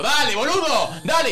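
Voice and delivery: high, loud impatient push at a slow driver; impatient urging. Retold or imitated. Stuck behind a slow old driver, impatient mock yell. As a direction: hurry the car ahead, mock exasperated. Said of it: "es tipo".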